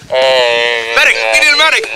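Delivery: man down shout. Acted. Golfer down after attack.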